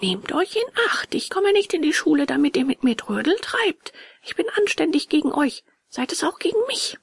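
high-voiced, mildly angry warning; understated anger, pitched high; indignant puppet boy. A narrator's reading. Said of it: "mit zornigem Blick".

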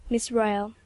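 usual speaking voice

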